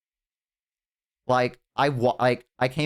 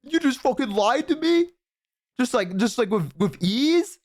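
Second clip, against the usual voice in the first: much higher, incredulous squeal; outraged disbelief; 11 semitones higher than usual. Retold or imitated. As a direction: shriek it in betrayed disbelief. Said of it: "I was like".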